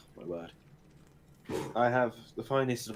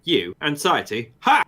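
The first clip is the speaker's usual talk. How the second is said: sneering panto villain; 6 semitones higher, 9 dB louder than usual. Pretend. Mock villain banter.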